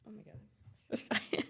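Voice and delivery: softly whispered apology through tears; tearful whisper apology. Real, not acted. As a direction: whisper the sorry, choked up. Apologizing while crying in interview.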